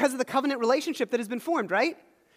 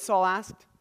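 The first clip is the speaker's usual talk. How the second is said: ordinary talk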